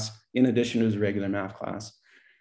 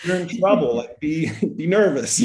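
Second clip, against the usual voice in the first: mock stern principal; 8 semitones higher, 5 dB louder than usual. Principal scolding Cody, half-laughing.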